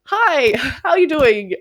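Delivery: slow, high, laughing hello; a hammed-up doorstep hello; mock greeting. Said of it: "not my pitch".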